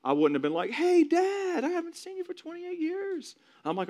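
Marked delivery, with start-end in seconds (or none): 0.7-3.3 mock greeting. Son imagining greeting dad on the street, mock greeting. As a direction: call out in an exaggerated high voice.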